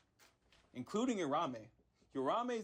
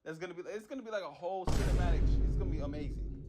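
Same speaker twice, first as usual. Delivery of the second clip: louder, faster, hyped-up build; excited hype; 8 dB louder, faster than usual. Real, not acted. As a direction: sell the cinematic with real excitement. Hyping the upcoming animation.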